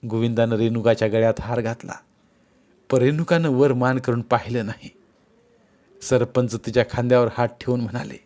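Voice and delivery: plain reading voice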